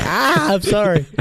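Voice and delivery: high mock-panicked yelp of apology; flustered apology. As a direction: blurt it out, comically flustered. Blurts apology when told off.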